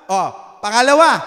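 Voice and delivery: usual speaking voice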